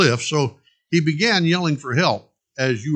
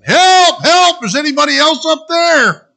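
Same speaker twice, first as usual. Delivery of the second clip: high, loud cries for help; desperate yelling; 12 dB louder than usual. Acted. Jack rejecting God's answer and yelling again, desperate shouts. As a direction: shout for anyone else, panicked. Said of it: "Jack yelling again".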